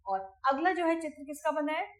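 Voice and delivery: ordinary delivery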